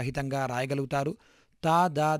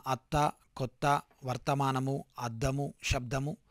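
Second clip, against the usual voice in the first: slow, careful example words; slow teacher's model of the doubled letters; 2 semitones lower, 3 dB quieter, slower than usual. Shown as a demonstration. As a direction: spell out అత్త, కొత్త, వర్తమానము slowly for the class. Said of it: "ఉదాహరణ".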